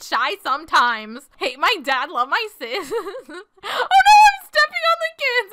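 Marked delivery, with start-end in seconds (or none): 3.3-5.5 very high squeal breaking into laughter; laughing panic. Real, not acted. Player finds they are stepping on kids, high laughing panic. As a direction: squeal it fast through giggles.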